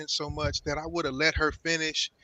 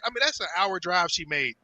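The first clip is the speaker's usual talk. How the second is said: ordinary talk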